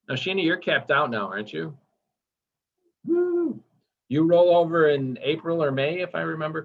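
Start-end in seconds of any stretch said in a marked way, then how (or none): 3.1-3.6 a sudden high whoop of celebration; high-pitched celebratory woo; excited whoop. Real, not acted. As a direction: cheer her on with a bright whoop. Cheering a colleague who capped out.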